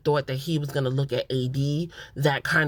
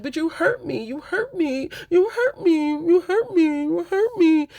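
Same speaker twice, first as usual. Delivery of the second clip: very high, whiny imitation of hurt; mock wailing; 12 semitones higher, 3 dB louder than usual. Imitating Chelsea gaslighting Jimmy, mock high wailing. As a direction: mimic a tearful complainer, pitched way up.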